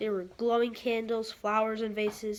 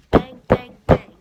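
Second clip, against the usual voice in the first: loud banging; 16 dB louder than usual. A narrator's reading. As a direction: hammer out each bang loudly. Banging spoon sound.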